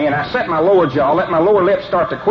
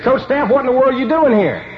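startled; 9 semitones higher than usual. Retold or imitated. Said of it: "He's startled to see me there".

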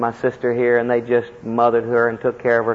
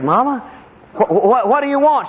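anxious son pleading; 12 semitones higher than usual. Retold or imitated. Brother pleading at dying mother's bed.

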